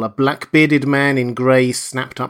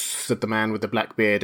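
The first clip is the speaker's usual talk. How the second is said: ordinary delivery, nothing marked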